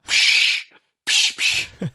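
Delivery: voiceless puffs of brake air, one after another; repeated air hiss. Retold or imitated. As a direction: pulse short hisses like a leaking brake. Crew chief hears brake pulses.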